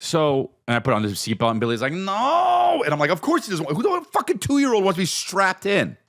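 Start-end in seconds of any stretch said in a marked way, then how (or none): 1.9-2.8 put-on very high, whiny toddler no; toddler refusal. 3.1-5.9 high, incredulous dad voice; exasperated. Retold or imitated. Dad's exasperated inner reply.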